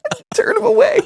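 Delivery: high squealing laughter; can barely get the words out laughing; laughing hard. Real, not acted. Cracking up at garbled line.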